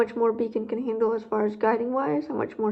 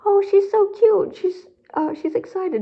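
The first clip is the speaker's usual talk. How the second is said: put-on much higher, louder, slow baby-talk gush; gushing mock onlookers; 9 semitones higher, 5 dB louder, slower than usual. Mimicking strangers who call the frightened dog cute, slow high gushing. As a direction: gush over the dog like a doting stranger, then settle. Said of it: "And they were like".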